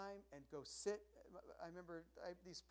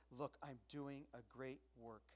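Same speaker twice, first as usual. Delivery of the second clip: slow, lowered and deliberate; slow resolve; 3 semitones lower, 6 dB quieter, slower than usual. Retold or imitated. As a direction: take your time, firm but soft. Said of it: "you just have to say".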